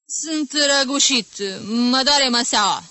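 high, slowing feigned sick voice; throaty garbled speech. A narrator's reading. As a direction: labour the words as if unable to speak. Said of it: "scoțând din gât sunete ininteligibile".

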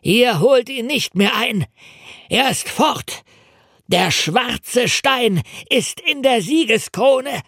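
high, exultant cry, slowing on the last line; triumphant shout. A narrator's reading. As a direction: crow your victory at your captor. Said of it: "schrie er".

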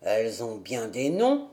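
put-on old grandfather. A narrator's reading.

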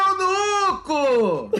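mock wailing eunuco, very drawn out; high dramatic proclamation. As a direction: cry out eunuco in a hammed-up falsetto. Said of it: "deixa eu fazer também".

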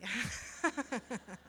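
short soft chuckle; a quiet real laugh, trailing; risa. Real, not acted. Laughs at own example. (risita suave)

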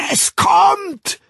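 very high, full-force yell; frenzied high roar; roaring shout. A narrator's reading. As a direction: bellow it at the top of your range. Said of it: "brüllte Jim Bokanowski".

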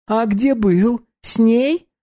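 put-on bright high young woman; high girl voice. Dominika voiced.

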